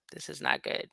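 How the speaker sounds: hushed, dropped to a whisper; whispered aside to herself. Real, not acted. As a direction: say it under your breath, dread sinking in. Whispered repeat to self.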